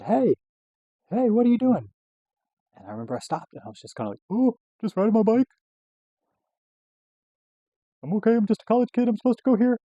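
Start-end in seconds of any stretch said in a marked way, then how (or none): 0.0-1.9 high, loud imitated cop's shout; calling out. Campus cop to swerving cyclist, calling out. 4.3-9.8 high, loud mock-innocent squeal; startled drunk student. Drunk college kid to campus cop, startled excuses.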